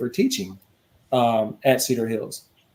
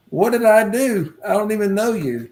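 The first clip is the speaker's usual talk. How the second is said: baffled protest; 8 semitones higher than usual. Retold or imitated. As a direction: ask it like you're truly lost. Confused by an accusation.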